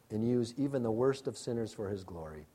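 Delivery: ordinary delivery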